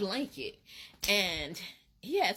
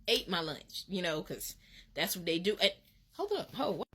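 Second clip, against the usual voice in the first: ordinary talk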